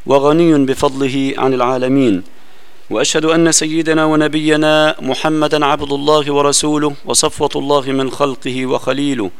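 measured, solemn recitation of the opening praise; soft, formal preacher's chant; formal sermon opening. Acted. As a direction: intone it like a preacher opening the Friday khutbah. Formal opening praise before lesson.

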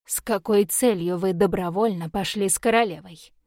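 ordinary delivery

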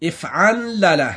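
"افعلل" said slowly, syllable by syllable; slow pattern demo. Shown as a demonstration. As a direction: spell out "افعلل" slowly for the class. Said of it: "في مثل وزن فاعلل". (نطق "افعلل" ببطء ووضوح)

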